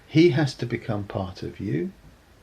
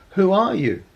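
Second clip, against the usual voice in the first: high, loud questioning call; yelling up; 9 semitones higher, 7 dB louder than usual. Acted. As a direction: shout the question up a pit. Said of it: "they yell up".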